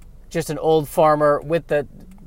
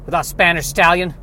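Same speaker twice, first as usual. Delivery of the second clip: put-on Southern old farmer. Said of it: "has a southern bent".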